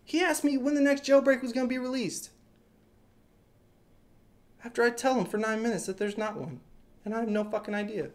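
soft, spent voice after an outburst; hushed, defeated recap; deflated disbelief. Real, not acted. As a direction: say it quietly, drained.

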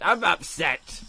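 upset outburst at the game. Real, not acted. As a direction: blurt it out, upset. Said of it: "I'm very upset".

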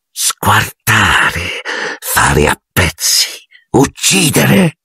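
slow, drawn-out eerie menace; chilling spectral voice, rising on the last word; cold murderous voice. A narrator's reading. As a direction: draw each word out like a disembodied killer. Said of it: "la stessa voce fredda e assassina".

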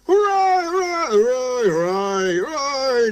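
high, swooping train-whistle hurrahs; whistled cheer. A narrator's reading. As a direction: cheer like a row of engine whistles. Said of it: "the engines whistled".

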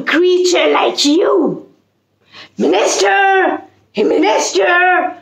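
high, shouting lion king in a rage; angry roaring king. Acted. Lion king roaring at the hedgehog to leave. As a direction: bellow it out like an offended king. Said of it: "the king cried".

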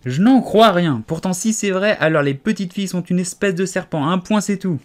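stubborn pigeon. A narrator's reading. Pigeon insisting.

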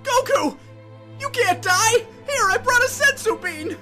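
high, urgent cry; high-pitched panicked urging; desperate plea. Acted. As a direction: beg your dying friend to hold on. Friend pleads with dying hero.

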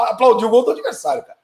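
usual speaking voice

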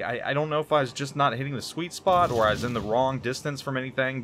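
usual speaking voice

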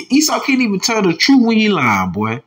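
high, laughing scorn; scoffing laugh through the words; laughing mockery. Real, not acted. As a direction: laugh at the liar as you say it. Mocking the admitted murderer.

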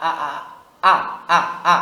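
repeated short, hard "আ" bursts; crisp, forceful "আ", each on its own; sharp hamza. Shown as a demonstration. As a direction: hit each "আ" firmly and cut it off. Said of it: "এটা হলো তীক্ষ্ণ হামজার ক্ষেত্রে".

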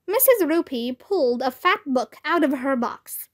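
ordinary delivery, nothing marked

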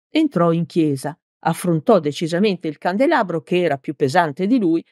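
ordinary delivery

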